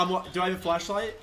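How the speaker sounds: usual speaking voice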